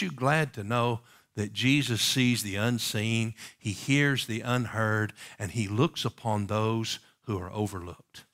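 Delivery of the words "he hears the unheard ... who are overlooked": usual speaking voice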